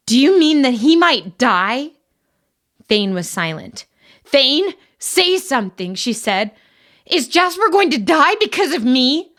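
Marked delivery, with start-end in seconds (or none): 0.1-1.9 alarmed. Acted. Marin shocked by news. 4.2-9.3 desperate. Acted. Marin desperate over Jasper.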